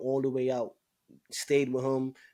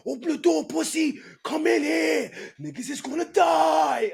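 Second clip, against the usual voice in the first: high, loud raging roar at the door; imitating a furious shout; 14 semitones higher, 6 dB louder than usual. Inmate kicking his cell door roaring at guards, loud high shout. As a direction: scream through the door like a giant. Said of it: "never raised his voice".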